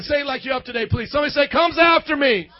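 rising shout to a sleepy church. Real, not acted. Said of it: "Y'all sleeping".

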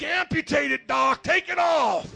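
high, loud hammed-up cries for help; mock pain yelling. Toe in agony, begging the doctor with mock yells. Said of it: "You're like".

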